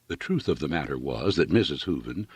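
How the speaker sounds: usual speaking voice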